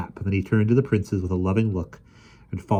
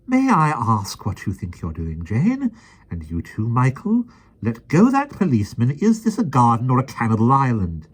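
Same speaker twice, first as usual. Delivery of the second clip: high, loud prim reproach; nanny scolding Jane and Michael; stern scolding; 6 semitones higher, 5 dB louder than usual. A narrator's reading. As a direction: scold the children sharply. Mary Poppins scolding children.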